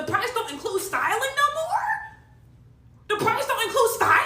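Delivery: high, drawn-out indignant squeal; indignant disbelief. Real, not acted. Client hears styling costs extra, indignant disbelief. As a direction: question it in rising, high-pitched outrage.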